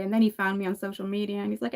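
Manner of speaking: usual speaking voice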